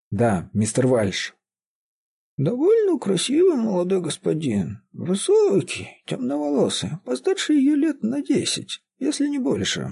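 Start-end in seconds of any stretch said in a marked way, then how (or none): 2.4-9.9 put-on very high old woman's voice; whining old caretaker. A narrator's reading. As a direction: describe him in a reedy old voice, high-pitched. Old woman describes the gentleman.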